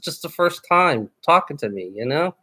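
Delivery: ordinary talk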